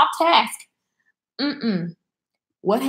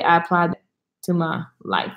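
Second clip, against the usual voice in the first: ordinary talk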